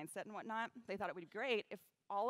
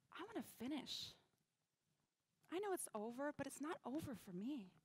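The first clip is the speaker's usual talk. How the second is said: soft, slightly raised, earnest plea; hushed, heartfelt retelling; quiet resolve; 3 semitones higher, 10 dB quieter than usual. Retold or imitated. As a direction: confide it softly but with determination. Quietly confides she must finish.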